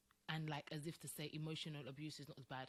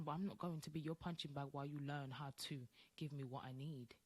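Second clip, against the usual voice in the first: ordinary talk, nothing marked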